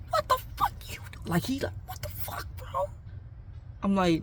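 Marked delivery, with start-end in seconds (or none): 0.1-2.9 high, breathy hissed disbelief; shocked whisper. Retold or imitated. Hiding friend whispers in shock at the narrator. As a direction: whisper it in horror, voice squeaking up.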